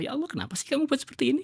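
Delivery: fast, high complaining whine; menyalahkan. Pretend. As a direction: accuse God in a rush. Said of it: "kita menyalahkan Allah". (hamba yang protes kepada Allah, cepat dan tinggi)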